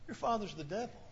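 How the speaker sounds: very soft, understated retort; quietly voiced comeback; hushed retold reply. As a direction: drop right down for the quoted answer. Imagined reply of Jesus, hushed.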